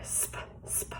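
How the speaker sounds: "Sp" voiceless and soft, repeated; breathed sound demo. Shown as a demonstration. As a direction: breathe "sp" out without voice. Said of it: "oops, breathe it on the paper".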